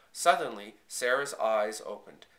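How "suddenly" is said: ordinary delivery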